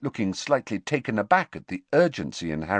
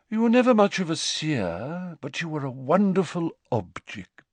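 high, airy seer reminiscing; Professor Trelawney, wistful; 9 semitones higher than usual. A narrator's reading. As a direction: lament theatrically in a raised pitch.

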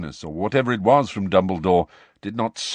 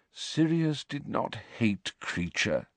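calm; 3 semitones higher, 7 dB quieter, slower than usual. A narrator's reading. Dumbledore calm reply.

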